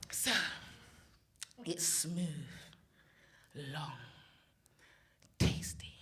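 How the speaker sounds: hushed, very slow, with long pauses; mock sultry poetry reading. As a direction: savour each word in a low near-whisper. Performs a mock sensual poem.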